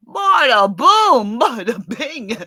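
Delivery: loud, high, drawn-out mock Italian; Italian accent. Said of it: "Can you do an Italian accent?".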